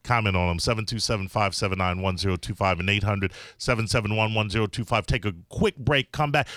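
brisk radio host voice; radio host read. Acted. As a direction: read the call-in line like an announcer.

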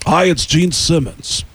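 slow rock star prank-call voice. Retold or imitated. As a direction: impersonate a star, dragging the words out. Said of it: "I prank called them as [name]".

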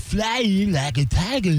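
nasal mock folk singer drawl; slow mock Dylan singing voice; imitating [name]. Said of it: "does he talk the way he sings".

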